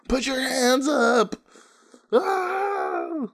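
high, drawn-out hype yell; mock stage yell. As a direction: imitate a performer yelling at a crowd. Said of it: "You wanna be on stage yelling".

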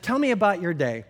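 ordinary talk

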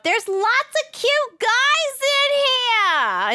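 called up high and slow; high screaming call. Retold or imitated. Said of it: "Val screams up".

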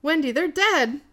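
lightly acted urgent bad news; mild retold alarm. [name] telling Wendy their friends are dead, mildly alarmed. Retelling panicked friend's line.

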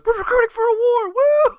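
high, loud shouting battle cry; excited A J. Retold or imitated. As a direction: yell it like an eager recruiter. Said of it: "And A J goes".

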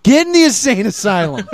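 loud comic command; hammed-up shouted order; mock order. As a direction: send him off with a laughing bark. Acts officials ordering prisoner in.

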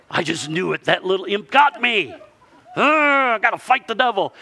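high, laughing rant at the imp. Retold or imitated. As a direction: lampoon someone blaming the devil for a flat.

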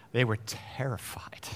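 very soft, low hush; dramatic whisper. Real, not acted. Preacher telling of the terrified disciples, dramatic whisper. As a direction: drop to a whisper for suspense.

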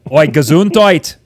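loud Cockney Oi, Gesundheit; hammed-up British accent; mock Cockney. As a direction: call it out like a cheeky Londoner. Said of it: "That's not a German accent".

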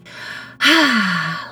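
long, open-mouthed sigh out; big sigh. Shown as a demonstration. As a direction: breathe it all out in a big sigh. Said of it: "a big sigh".

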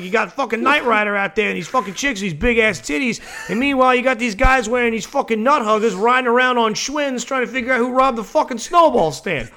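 a son hamming up his dad's scorn; imitating dad. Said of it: "who's like".

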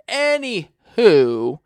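loud, exaggeratedly slow topic switch; drawn-out segue. Real, not acted. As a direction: stretch it out to steer away from the topic. Awkwardly changing the subject.

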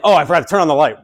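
put-on the old priest catching his mistake aloud; surprised priest. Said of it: "the priest's like".

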